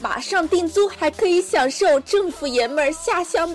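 hyped-up TV sales pitch; over eager infomercial. Pretend. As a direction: sell it like a late-night ad. Mock advert for renting men.

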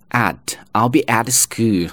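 "at" with full vowel and pronounced t; full form. Shown as a demonstration. Teacher allowing the unreduced option, full clear "at". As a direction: keep "at" unreduced before school. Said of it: "你也可以发成at".

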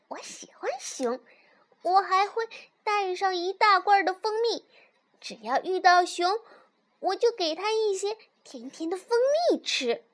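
high, happy cub voice; cheerful little fox. A narrator's reading. Little fox explains the honey plan to mother, cheerful high voice. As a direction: pitch it up, pleased with the plan. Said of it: "小狐狸笑着说".

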